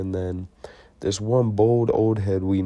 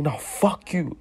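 high-pitched argumentative comeback; acted retort; 7 semitones higher, slower than usual. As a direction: throw it back, voice pitched up. Acts out the escalating argument.

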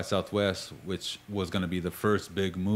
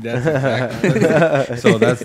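laughing; 6 semitones higher, 15 dB louder, slower than usual. Real, not acted. Laughing at the family story.

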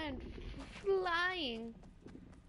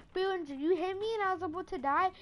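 usual speaking voice

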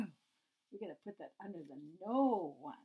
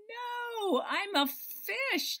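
high, slow fish reply; 12 semitones higher, 5 dB louder, much slower than usual. Acted. Fish answering the seeking cat, high and slow.

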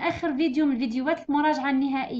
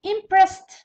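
Impressed called out higher and louder, final t; emphatic model word; 5 semitones higher, 8 dB louder than usual. Shown as a demonstration. Teacher calling out a word, loud model. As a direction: announce Impressed to the class. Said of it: "ت".

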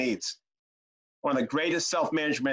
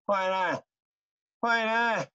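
mock dying gasp, pitched up; gasping for breath; 4 semitones higher, much slower than usual. Dying elder hears grandkid's times tables, mock gasping. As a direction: act out a feeble last-breath answer. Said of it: "gasping for breath".

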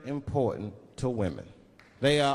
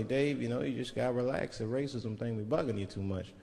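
mock calm advice; 6 semitones lower, 6 dB quieter than usual. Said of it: "a lot of people be telling me".

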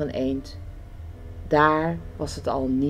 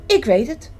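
higher and louder, sudden idea; calling out; 7 semitones higher, 4 dB louder than usual. A narrator's reading. Frog with a rescue idea for hungry friends, calling out. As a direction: burst out with a plan. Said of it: "riep Kikker".

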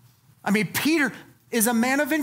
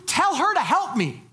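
higher, bossy demand; 7 semitones higher than usual. Retold or imitated. Martha commanding Jesus to send her sister, demanding. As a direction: order him around like a put-upon sister. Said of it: "don't command Jesus to do anything".

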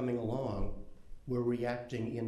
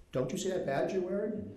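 higher, mock-incredulous retort; incredulous question; 7 semitones higher than usual. Reporter imagining a retort to badge-wearing interviewees, mock-incredulous. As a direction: gently needle them about the badge. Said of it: "and I wanna say".

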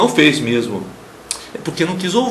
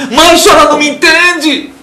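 put-on higher, loud teenage retort; defiant daughter; 12 semitones higher, 8 dB louder than usual. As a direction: snap back like a rebellious teen. Rebellious daughter answers mother.

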